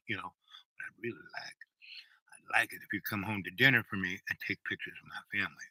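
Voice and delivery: unvoiced whisper, low. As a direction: whisper it like a secret. Whispered aside in retold request.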